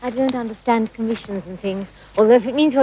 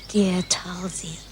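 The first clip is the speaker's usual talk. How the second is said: whisper; 6 semitones lower, 8 dB quieter, much slower than usual. Acted. Flustered reply to proposal.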